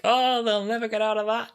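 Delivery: high-pitched mock-confident chirp; Jamie. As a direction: sing it out high and carefree. Acting out Jamie in the story.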